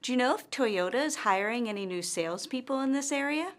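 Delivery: yes-no question climbing through "in this area"; rising intonation. Shown as a demonstration. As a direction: lift the pitch steadily to the end of "area". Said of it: "her intonation rises at the end".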